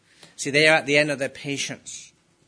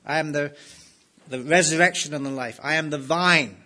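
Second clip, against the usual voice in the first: preaching; 3 semitones higher, 5 dB louder than usual. Acted.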